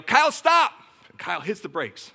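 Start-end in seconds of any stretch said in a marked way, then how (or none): 0.0-0.7 loud, high shout of warning; sudden alarmed parental shout; urgent yell. Retold or imitated. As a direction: yell to halt a child in danger. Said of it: "And I yell".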